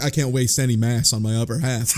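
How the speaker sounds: ordinary talk, nothing marked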